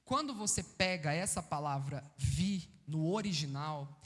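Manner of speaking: ordinary talk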